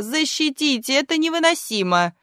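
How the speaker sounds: high, theatrical lament; pleading old woman. A narrator's reading. Old actress begging the manager for protection, wailing. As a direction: implore him in hammed-up distress.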